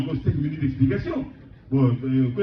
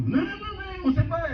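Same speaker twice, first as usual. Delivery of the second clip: high-pitched mock wail, far above usual voice; mimicked protest; 11 semitones higher than usual. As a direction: imitate people protesting in a high voice. Rejoue des gens qui protestent. (protestation imitée, voix très aiguë)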